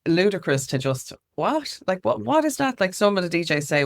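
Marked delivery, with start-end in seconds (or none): 1.3-2.8 incredulous, pitched way up; mock disbelief at a silly question; imitating a bewildered reaction; baffled what. Quoting baffled radio heads' responses.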